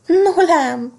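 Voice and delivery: high, loud exclamation of amusement; mock-laughing thread. A narrator's reading. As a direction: exclaim it high with a touch of mirth. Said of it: "kacagott a cérna". (kacagást imitáló, magas felkiáltás)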